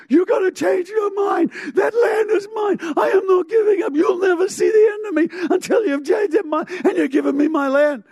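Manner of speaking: very high, shouted rant; screaming demand. Acted. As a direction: scream the demands without letting up. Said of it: "she just screams".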